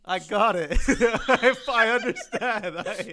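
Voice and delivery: loud laughing, high; laughing hard. Real, not acted. Co-host set up for the punchline, loud laughter. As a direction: crack up mid-word.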